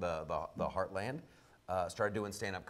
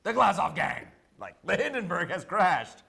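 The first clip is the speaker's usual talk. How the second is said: dramatic disaster-news announcer, higher and louder; mock grave newsreader; 7 semitones higher, 7 dB louder than usual. Guest imitating host, announcing doom like old newsreel. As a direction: boom it out like tragic breaking news. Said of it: "You were all like".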